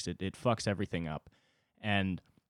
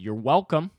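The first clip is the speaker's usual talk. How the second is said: higher, louder sarcastic yell; 5 semitones higher, 6 dB louder than usual. Retold or imitated. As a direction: yell the thanks she never gave, with sarcasm. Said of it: "and I yelled".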